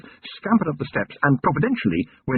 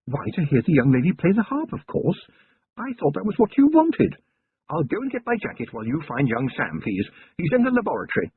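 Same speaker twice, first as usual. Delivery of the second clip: affected surprise; 6 semitones higher than usual. A narrator's reading. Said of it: "Sybil affected surprise".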